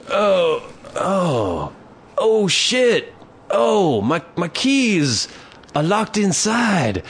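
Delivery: hammed-up panic. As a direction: ham up fake panic like a drunk liar. Imitating guy faking lost keys.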